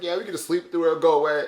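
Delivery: imitating a friend, high and loud; Londa. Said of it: "And, and Londa's like".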